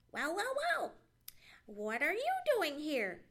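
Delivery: high, sharp squirrel voice. A narrator's reading. Squirrel surprising Johnny in the log, sharp cry. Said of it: "cried a sharp voice".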